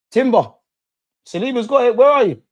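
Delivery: loud, high urgent calling; excited fan shouting during an attack. Real, not acted. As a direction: urge the players on mid-attack.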